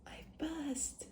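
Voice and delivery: high, breathless whisper of disbelief; stunned whisper. Real, not acted. Learner sees the exam result, whispered disbelief. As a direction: whisper it as if you can't believe it.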